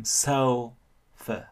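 sofa slow, first syllable long and loud; stress on first syllable. Shown as a demonstration. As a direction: lean on SO in sofa. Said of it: "đọc dài hơn, to hơn, rõ hơn". (sofa nhấn âm đầu dài hơn, to hơn)

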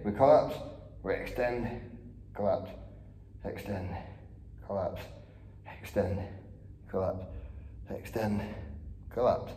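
low, quiet, breathless counting; strained counting. Real, not acted. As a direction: count each rep through the effort. Coach counts reps while exercising.

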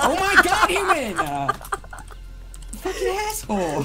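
furious outburst. Real, not acted. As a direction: blow up at your friend. Furious at friend sniping.

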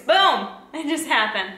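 sudden loud triumphant burst; excited. Real, not acted. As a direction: shout it like a win. Camera finally focuses.

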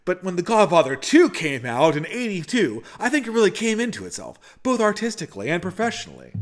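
high-pitched, swooping mock film-critic monologue; Patrick Bateman. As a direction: parody the American Psycho killer reviewing earnestly. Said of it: "part of the Patrick Bateman routine".

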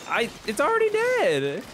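high, loud and drawn out; exasperated protest. Real, not acted. Player telling teammate it's dead, exasperated. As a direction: protest slowly with a rise at the end.

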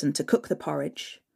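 ordinary delivery, nothing marked

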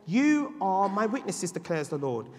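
loud, slowing to a crawl; slow preaching. Acted. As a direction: proclaim God's words deliberately.

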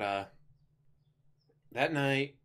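normal talking voice

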